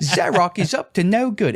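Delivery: put-on playful British accent. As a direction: announce it in a hammy British accent. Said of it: "it's fun to do British accents".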